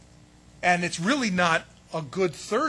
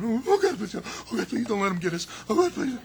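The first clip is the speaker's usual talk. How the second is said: high, loud frightened begging with a laugh in it; mock-panicked disciples pleading; panicked praying; 4 semitones higher, 5 dB louder than usual. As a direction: ham up the terror, beg God not to let him get us. Disciples cowering in upper room.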